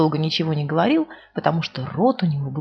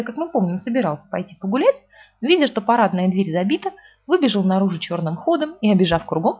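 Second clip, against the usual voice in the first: ordinary delivery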